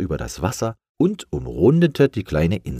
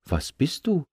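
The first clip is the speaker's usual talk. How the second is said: curious; 9 semitones higher than usual. Acted. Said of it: "setzte er noch neugierig hinzu".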